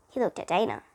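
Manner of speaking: ordinary delivery, nothing marked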